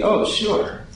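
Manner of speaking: breezy, high-pitched oh sure; confident. Retold or imitated. Disciples to Jesus, breezy confident agreement. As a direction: toss it off like it's nothing. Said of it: "they say to him confidently".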